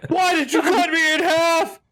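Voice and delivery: high, strained dying scream; wounded soldier gasping. Pretend. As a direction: cry out as if cut in half. Voicing dying human.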